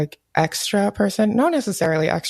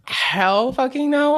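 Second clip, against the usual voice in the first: defiant; 6 semitones higher, much slower than usual. Retold or imitated. Defiant retold reaction.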